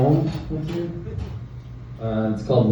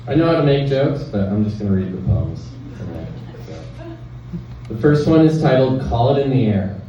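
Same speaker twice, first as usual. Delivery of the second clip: usual speaking voice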